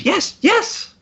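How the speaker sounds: very high excited squeal; squealing at top pitch. Acted. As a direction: squee like a starstruck fan. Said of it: "at the top of her pitch range".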